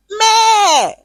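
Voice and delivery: high, drawn-out goat bleat; imitated goat's "meh"; mocking goat bleat. A narrator's reading. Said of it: "goats in the market bleated".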